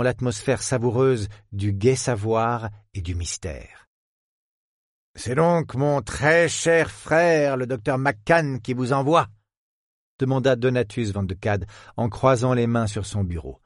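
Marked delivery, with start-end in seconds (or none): 5.2-9.3 put-on slow, high old professor voice; old scholar. Professor asks the detective about his brother, slow and high. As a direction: draw it out like a slow old academic.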